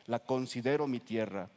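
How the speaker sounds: ordinary talk, nothing marked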